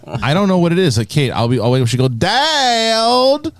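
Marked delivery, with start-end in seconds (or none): 2.1-3.5 put-on high, drawn-out Southern little kid; Southern accent. Dad imitating his three-year-old calling him, Southern accent. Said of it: "now has a Southern accent".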